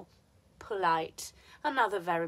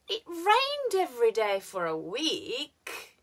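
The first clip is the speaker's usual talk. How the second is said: WEEK drawn out, dipping then lifting; slow, leave "week" unfinished; hanging fall-rise on "week"; fall-rise intonation; 4 semitones higher, slower than usual. Shown as a demonstration. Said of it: "we haven't finished speaking yet".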